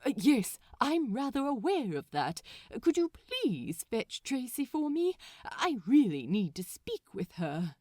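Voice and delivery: overly proper, a bit rattled; flustered Aziraphale. Acted. Aziraphale on phone.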